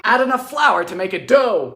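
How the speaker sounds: ordinary talk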